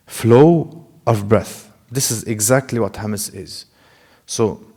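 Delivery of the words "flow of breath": "Flow of breath" drawn out very slowly; slow emphatic definition. Shown as a demonstration. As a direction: spell out the definition word by word, slowly. Teacher repeats the key definition.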